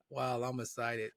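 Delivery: slow, deadpan mock excitement; imitating a bored monotone, low and slow. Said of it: "your monotone voice".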